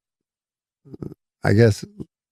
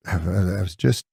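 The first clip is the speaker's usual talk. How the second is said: usual speaking voice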